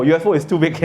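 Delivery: ordinary delivery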